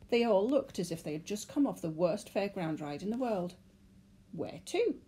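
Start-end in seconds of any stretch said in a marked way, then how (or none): 4.3-5.0 high puzzled question; bemused clerk asking. A narrator's reading. Clerk asks.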